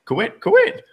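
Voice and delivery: very high chirping cry; squeaky bird call. Acted.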